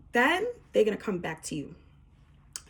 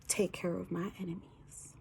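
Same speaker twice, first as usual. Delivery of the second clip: hushed, breathy whisper to God; whispered prayer; 7 dB quieter than usual. Acted. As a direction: whisper the plea like a secret prayer.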